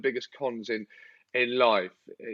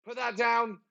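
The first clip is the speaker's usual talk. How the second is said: scolding dog; 9 semitones higher than usual. Retold or imitated. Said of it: "you just go".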